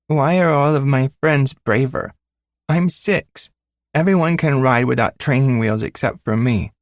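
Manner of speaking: put-on high, tearful-sounding child voice; a six-year-old near tears; upset little girl. Daughter complaining to her father about her braver friends, high child voice.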